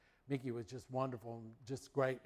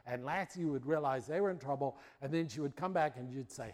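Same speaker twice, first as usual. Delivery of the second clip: ordinary delivery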